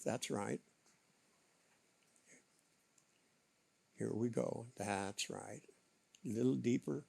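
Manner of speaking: soft spa whisper. Acted. Hypnotic relaxation induction.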